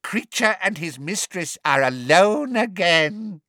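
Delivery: high, slow, gleeful elf; gloating elf. A narrator's reading. Elf gloats about being alone with mistress, slow. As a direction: savour it slowly, delighted.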